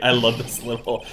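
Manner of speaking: usual speaking voice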